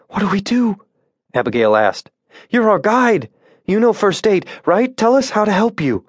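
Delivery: high frantic pleading; panicked plea. A narrator's reading. Abigail to the wounded guide, high panicked pleading.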